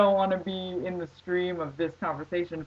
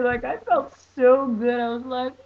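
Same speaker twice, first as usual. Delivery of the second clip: ordinary delivery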